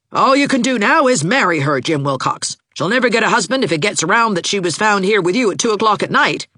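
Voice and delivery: high-pitched scolding aunt; belligerent. A narrator's reading. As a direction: lay down the law, pitched high. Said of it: "said Aunt Mouser belligerently".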